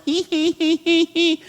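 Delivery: high honking hee hee laugh; obnoxious laugh. Shown as a demonstration. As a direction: laugh like a car horn. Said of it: "this obnoxious laugh".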